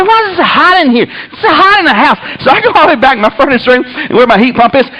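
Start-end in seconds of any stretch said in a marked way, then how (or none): 0.0-2.2 high, baffled complaint; exasperated. Retold or imitated. Sweating man questioning the heat, exasperated. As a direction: imitate overheated, indignant disbelief.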